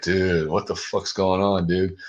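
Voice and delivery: ordinary talk, nothing marked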